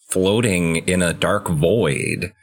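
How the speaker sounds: ordinary delivery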